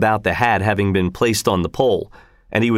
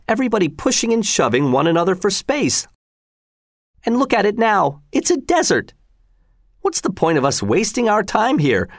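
animated complaint, climbing higher; grumbling soldier; 6 semitones higher than usual. Acted. Soldier Frieshardt moaning about the empty field, grumbling. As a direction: gripe with rising exasperation in a high voice.

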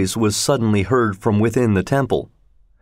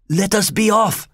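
high, harsh command; 10 semitones higher than usual. A narrator's reading. Awakened Rajah to Sir Francis and Mister Fogg, harsh tone. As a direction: order them off harshly in a raised pitch. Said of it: "in a harsh tone".

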